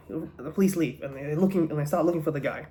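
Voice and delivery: ordinary talk, nothing marked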